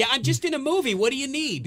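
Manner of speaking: lampoon a clueless moviegoer taking a call; mimicking a phone caller. Mimicking man answering phone in theater.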